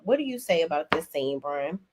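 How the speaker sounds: usual speaking voice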